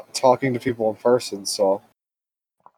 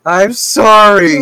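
mock wailing apology; 9 semitones higher, 10 dB louder, much slower than usual. Mock dramatic apology to friend.

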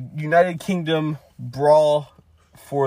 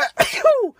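loud, high sneeze; sudden sneeze mid-talk; 5 dB louder than usual. Real, not acted. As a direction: let out a sharp, high achoo. Sneezes during recap, gets blessed.

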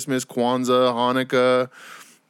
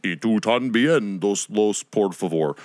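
mock Spanish; slower than usual. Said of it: "speak another language".

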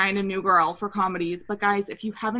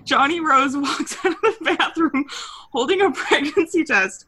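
ordinary delivery

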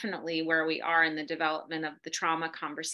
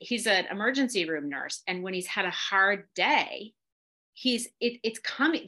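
usual speaking voice

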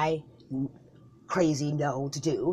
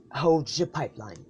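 ordinary talk

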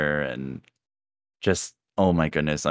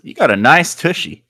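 high, loud cheeky compliment; 11 semitones higher, 8 dB louder than usual. Retold or imitated. Older woman compliments his backside, high and cheeky. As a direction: tease him cheekily. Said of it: "She goes".

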